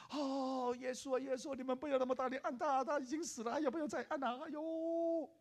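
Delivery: high, pleading wail of a mother; imitated distraught mother pleading; crying mother. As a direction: beg them to stop pressing her dead son. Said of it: "那个妈妈在旁边哭啊".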